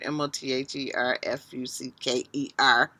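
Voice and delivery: M O T H E R F U C K E R spelled out quietly, one letter at a time. Shown as a demonstration. Spelling out the bad word so she doesn't have to say it. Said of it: "You know that bad word".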